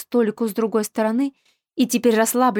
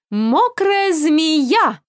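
higher, louder, very slow; triumphant cry; 6 semitones higher, 4 dB louder, much slower than usual. A narrator's reading. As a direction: drag out the punchline gleefully. Punchline shouted at rival.

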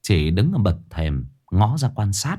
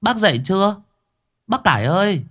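gọi to; 11 semitones higher than usual. A narrator's reading. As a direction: call over the gate to wake her. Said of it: "Đoạn cô ngửa cổ nói to". (gọi to qua cổng)

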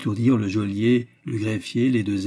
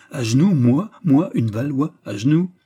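louder, higher, outraged disbelief; indignant exclamation; 4 semitones higher, 6 dB louder than usual. A narrator's reading. Proud Valois told to kneel, crying out indignantly. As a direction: cry out in affronted astonishment. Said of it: "s'écria Jeanne".

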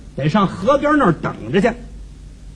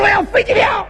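high-pitched holler, far above talking voice; comic shouted cry; 11 semitones higher than usual. Acted. Performer quoting himself hollering for a plane ticket, high shout. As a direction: belt it out high like a street shout. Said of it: "就这嗓子".

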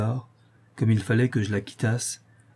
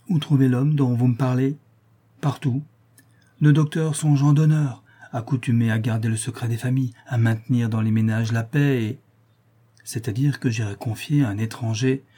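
plain reading voice

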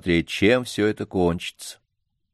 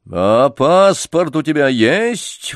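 louder, slow menacing roar; 6 semitones higher, 6 dB louder, slower than usual. A narrator's reading. Doctor suddenly roars at the organ grinder, menacingly. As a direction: roar it threateningly. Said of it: "вдруг грозно взревел доктор".

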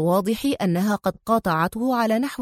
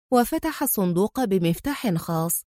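ordinary delivery

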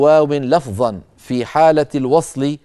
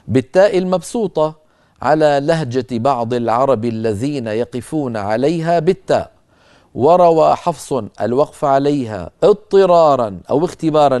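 normal talking voice

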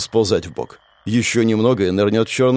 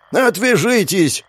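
slow, higher, desperate yell; helpless yell; 9 semitones higher, slower than usual. A narrator's reading. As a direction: bellow it, at wit's end. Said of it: "проорал он в бессилии".

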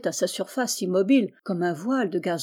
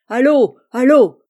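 much louder and higher, a savage howl; howling yell; 4 semitones higher, 11 dB louder than usual. A narrator's reading. Mutineer howling to throw the captain overboard, savage yell. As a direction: scream for him to be thrown over. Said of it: "hurlait Quéruon".

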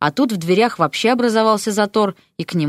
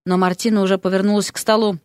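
usual speaking voice

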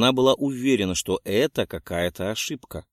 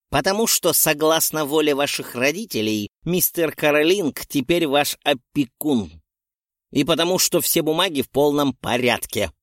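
crisp clipped statement; 4 semitones higher, 4 dB louder than usual. A narrator's reading. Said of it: "отчеканила старая ведьма".

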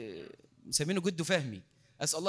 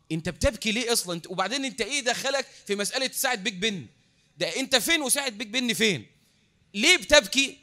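louder, incredulous questioning, building to a peak; acted exasperation; 4 semitones higher, 5 dB louder than usual. Baffled people press a weeping sheikh about Big Ben, acted exasperation. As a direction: badger him as the baffled questioners, peaking on the last question.

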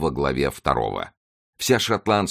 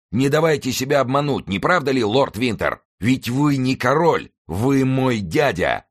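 exclaiming; 8 semitones higher than usual. A narrator's reading. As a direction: taunt an enemy across the field. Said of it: "воскликнул всадник". (высокий торжествующий возглас)

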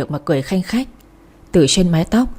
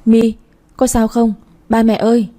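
understated cry for help, raised pitch; mild calling out; 4 semitones higher than usual. A narrator's reading. Narrator calls to My and the parents after pushing her, mildly crying out. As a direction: call out in feigned alarm, pitched higher. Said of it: "rồi kêu lên".

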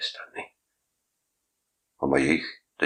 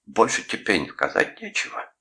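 raised pitch, indignant rebuke; 6 semitones higher than usual. A narrator's reading. Uncle outraged by his nephew's reply, indignant. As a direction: scold with outraged disbelief. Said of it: "возмутился дядя Гарольд".

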